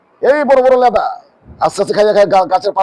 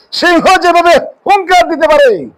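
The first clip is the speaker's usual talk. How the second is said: roaring preacher; 8 semitones higher, slower than usual. Acted. Preacher evoking a lion's roar.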